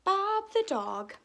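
high, loud, bright title call; excited title announcement. A narrator's reading. Title repeated with flourish.

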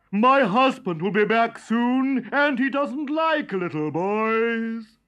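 giantess warning. A narrator's reading. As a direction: warn the boy about her husband. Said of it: "said the woman".

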